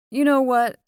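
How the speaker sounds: bursts out loud to change the subject; sudden loud deflection. A narrator's reading. Knox blurts to dodge talk of his dead parents, suddenly loud. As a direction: blurt it out, brushing the grief aside.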